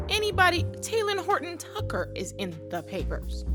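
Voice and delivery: ordinary talk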